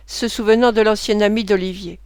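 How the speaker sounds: plain reading voice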